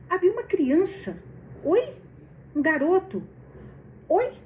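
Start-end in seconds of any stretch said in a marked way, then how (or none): 1.6-4.3 sudden high surprised "oi"; startled, high and loud. Real, not acted. Reader reacts to boy appearing.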